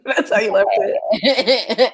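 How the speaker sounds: slow, laughing repeat of the question; laughing disbelief. Real, not acted. Cohost laughing at the friend who left it that way. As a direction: laugh through the words in disbelief.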